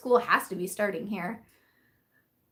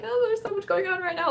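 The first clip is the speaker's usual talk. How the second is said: much higher, harried wail; overwhelmed; 10 semitones higher than usual. Retold or imitated. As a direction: sound swamped and frazzled. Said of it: "I was so overwhelmed".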